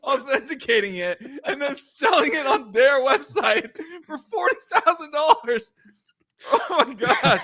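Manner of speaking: high, loud cackling glee; gleeful laughing. Real, not acted. Gleeful shout-out to rival's loss.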